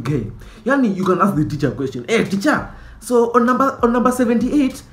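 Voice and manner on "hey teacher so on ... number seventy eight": lightly voiced eager know-it-all, higher; a hint of a nerdy pest; annoying student voice. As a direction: needle the teacher with a pointless question. Mocking the annoying student's question.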